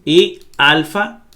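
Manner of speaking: normal talking voice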